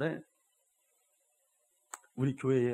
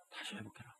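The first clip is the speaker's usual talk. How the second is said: whispered plea to recover; hushed command; 3 semitones lower, 15 dB quieter than usual. Retold or imitated. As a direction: drop to a whisper for the last order. Quoted warning ends hushed.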